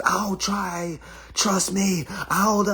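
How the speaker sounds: high, pleading put-on partner; squeaky mock promise; mock eager voice. Mocking a hesitant partner, high pleading.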